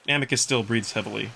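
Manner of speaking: ordinary delivery, nothing marked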